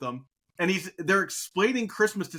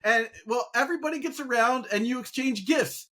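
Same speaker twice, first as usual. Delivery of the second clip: put-on higher-pitched kid's explanation; retold kid explaining; 6 semitones higher than usual. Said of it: "one kid's like".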